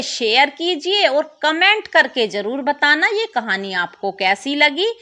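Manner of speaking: ordinary delivery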